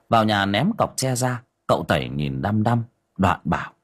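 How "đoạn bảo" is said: ordinary delivery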